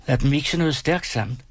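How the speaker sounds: a woman asking for something strong, lifted a little; Betty's voice. A narrator's reading. Betty's line, voiced high. (kvindestemme, lidt lysere)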